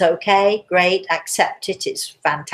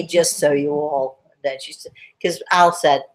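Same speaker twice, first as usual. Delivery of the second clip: normal talking voice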